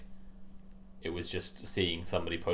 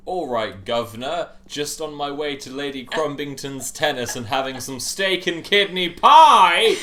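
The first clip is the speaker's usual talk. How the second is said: put-on higher and louder silly posh English accent; bad American English accent; 7 semitones higher, 11 dB louder than usual. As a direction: ham up a toff English accent, rising to a shriek. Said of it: "American doing an English accent".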